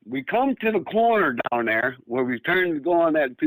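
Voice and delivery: plain reading voice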